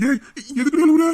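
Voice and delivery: high, loud, startled question; alarmed. Acted. Man startled by her sudden crying, alarmed. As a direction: blurt it out in alarm.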